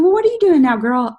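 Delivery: put-on high, loud, eager girlfriend question; excited friend question. Shown as a demonstration. Friend curiously asking what she's doing now, high and eager. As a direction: squeal it to your girlfriend.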